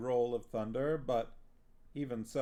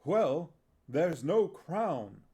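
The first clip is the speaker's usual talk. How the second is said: worried actor; 5 semitones higher, 7 dB louder, much slower than usual. Acted.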